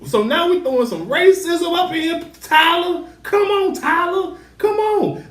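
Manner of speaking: high, pleading exasperation; high incredulous plea to a TV character; exasperated. Real, not acted.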